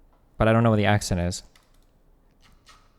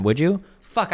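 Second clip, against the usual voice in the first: ordinary delivery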